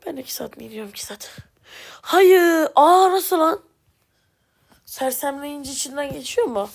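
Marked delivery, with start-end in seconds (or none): 0.0-1.4 soft whisper, grumbling; whispered complaint. Real, not acted. Complaining under breath. 2.0-3.6 loud, higher cry of shock; a gamer shouting in shock at what just happened; shocked outcry. Real, not acted. Shocked by game event.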